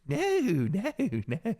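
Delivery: high, hammed-up British accent; exaggerated Harry Potter English, laughing. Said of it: "it sounded like a Harry Potter".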